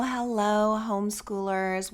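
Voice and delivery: usual speaking voice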